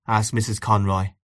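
usual speaking voice

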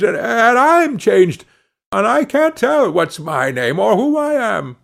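high, bewildered, mounting distress; at wits' end. A narrator's reading. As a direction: plead in utter confusion, pitched well up. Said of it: "exclaimed he, at his wits' end".

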